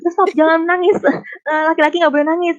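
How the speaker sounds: high, loud mock telling-off with a laugh breaking in; mock parental scolding. Indonesian parent telling a boy not to cry, mock scolding.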